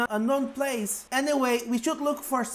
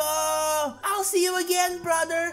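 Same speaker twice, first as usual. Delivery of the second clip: higher, drawn-out goodbye call; calling out farewell; 4 semitones higher, slower than usual. Acted. Friends calling to Marco as the boat leaves, calling out farewell. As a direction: call it out across the water, holding the notes.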